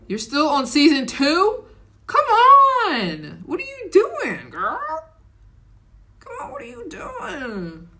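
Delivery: high squealing come on, what are you doing; exasperated disbelief. Real, not acted. To a viewer still on season two, high shrieking exasperation.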